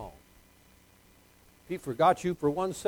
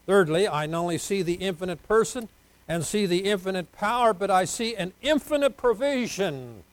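loud, raised sermon-point delivery; emphatic preaching; 5 semitones higher, 5 dB louder than usual. Real, not acted. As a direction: proclaim each point to the congregation. Announces sermon point forcefully.

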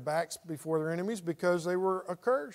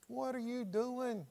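higher, softer, drawn-out reproach; mock exasperated scolding; 5 semitones higher, 9 dB quieter, slower than usual. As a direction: stretch it out in soft disbelief. God scolding Joshua, in paraphrase.

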